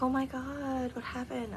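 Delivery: imitating a distressed aunt, high-pitched; tearful shock. Aunt hearing her sister has died, imitated tearful shock. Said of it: "she starts crying".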